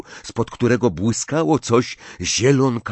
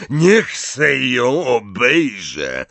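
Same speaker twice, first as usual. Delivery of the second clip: slow, drawn-out big voice; shouted order; much slower than usual. A narrator's reading. Hagrid wants to examine Katy, calling out slowly. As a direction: take charge, stretching each word. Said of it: "krzyknął Hagrid".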